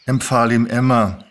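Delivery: plain reading voice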